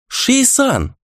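high, shaking cry of fear; frightened shout. A narrator's reading. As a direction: cry out in helpless terror. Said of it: "крикнул дрожащим от страха и беспомощности голосом".

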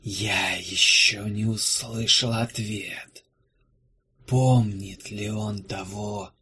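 slow, low, hushed spirit voice; whispered ghostly pronouncement; eerie whisper. Acted. Unseen spirit speaks.

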